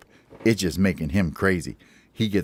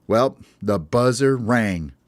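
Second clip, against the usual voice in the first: plain reading voice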